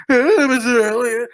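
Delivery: a hint of a nervous creep saying hello; creepy fan greeting. To a celebrity in the store, mildly creepy greeting. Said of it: "even be extra creepy where I'm like".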